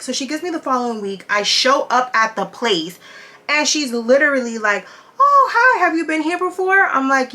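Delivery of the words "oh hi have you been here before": much higher and louder, a put-on customer-service hello; mock receptionist greeting. The receptionist greeting her at the door, mock chirpy. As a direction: parody the clueless front desk girl.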